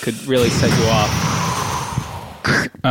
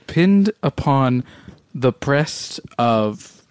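slow recital; much slower than usual. Acted. Slow poetic recital of own poem.

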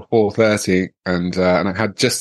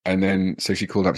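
usual speaking voice

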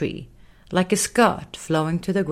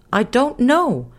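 put-on higher, slow little girl, unsure; 7 semitones higher, 4 dB louder, slower than usual. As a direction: admit she has no idea. Belle answers unsure.

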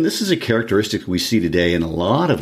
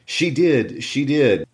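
put-on childish taunt; 7 semitones higher, much slower than usual. Linton taunting.

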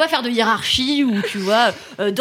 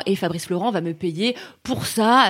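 usual speaking voice

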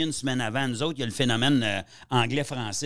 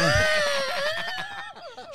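howling laugh. Real, not acted. Cracking up at a friend's joke, howling with laughter. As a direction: burst out laughing till it squeaks.

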